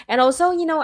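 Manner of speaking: ordinary delivery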